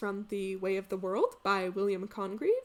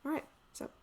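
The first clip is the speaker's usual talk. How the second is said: ordinary delivery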